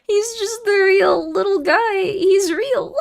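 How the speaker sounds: high, tearful wail over a sad edit; half-crying at how real he looks; teary whimper. Real, not acted.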